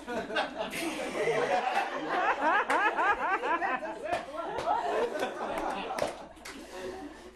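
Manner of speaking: long burst of real laughter; helpless laughing fit; loud laughter. Real, not acted. Reader laughs at her slip.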